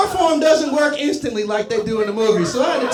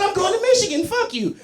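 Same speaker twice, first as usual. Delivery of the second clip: pitched way up, defiant mock retort; defiant kiss-off; 9 semitones higher than usual. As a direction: fling it back high and scornful. Defiant retort to Ohio dealer.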